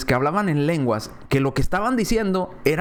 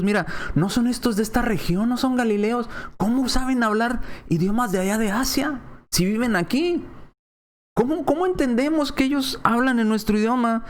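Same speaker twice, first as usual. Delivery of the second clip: raised, astonished questioning; amazed crowd; 8 semitones higher than usual. Acted. Preacher voicing amazed listeners, raised astonished questions. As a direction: voice the crowd's disbelief, pitched up.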